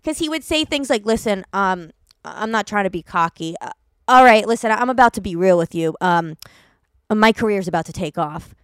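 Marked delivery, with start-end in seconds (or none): 1.0-8.5 lower, laid-back bro voice; a lightly voiced cocky LA guy. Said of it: "he was so very L A".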